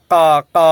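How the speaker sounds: কা cawed loud and harsh like a crow. Shown as a demonstration. Teacher imitating a crow to show the throat sound. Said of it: "ওই যে কাক যেরকম ডাকে না".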